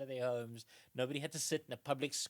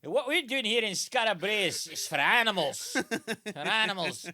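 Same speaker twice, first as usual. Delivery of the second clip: much higher and much louder, laughing through a ranting father; outraged Scottish dad; 6 semitones higher, 14 dB louder than usual. Retold or imitated. Dad scolding his son home from London, laughing loud rant. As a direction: berate the son like a furious old Scot. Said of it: "to his dad".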